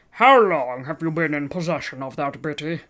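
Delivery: pinched nasal cop, matter-of-fact; police officer questioning in a nasal voice. Acted. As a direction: read it like a bored officer through the nose. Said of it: "I'm only doing nasal voices".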